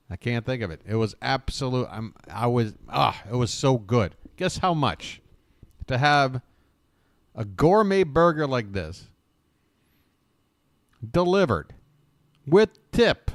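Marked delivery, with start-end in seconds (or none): 4.4-13.3 excited guessing question. Real, not acted. Teasing viewers with a guessing question.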